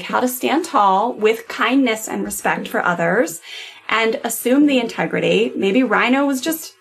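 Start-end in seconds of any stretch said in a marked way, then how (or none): 5.6-6.7 playful laughing. Real, not acted. Playful joke about rhino.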